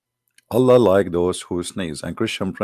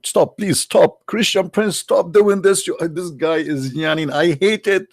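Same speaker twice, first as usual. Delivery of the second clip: high whiny pleading voice; mock whiny pleading; 9 semitones higher than usual. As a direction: whine like an annoyed god. Voicing God pleading mockingly.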